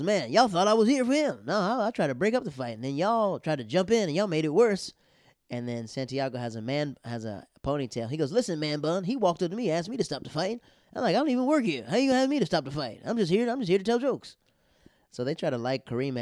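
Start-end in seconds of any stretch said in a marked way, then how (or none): 0.0-4.9 indignant mock complaint from the stage; retold protest. 8.3-14.4 mock indignant rant at the producer; retold scolding. Kareem berates producer from stage.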